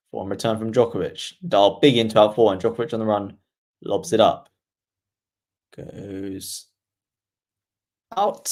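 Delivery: steady commentator call, shot by shot; play-by-play commentary. Acted. Streamer calling the rally for viewers, commentator voice.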